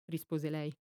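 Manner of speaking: ordinary delivery, nothing marked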